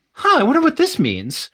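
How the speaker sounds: high-pitched innocent wondering; curious student. Retold or imitated. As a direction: wonder aloud, voice lifted high. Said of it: "I'm like".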